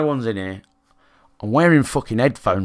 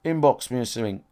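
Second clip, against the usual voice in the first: ordinary talk, nothing marked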